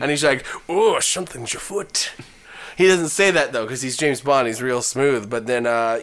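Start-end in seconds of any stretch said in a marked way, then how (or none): 0.7-2.1 mock Scottish spy voice; Scottish accent. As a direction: put on a slurred Scottish brogue. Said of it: "overcome by his Scottish accent".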